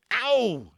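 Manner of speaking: high, loud shriek of pain; ow as the shell hits the thumb; a sudden loud yelp of pain. A narrator's reading. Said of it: "he shrieked".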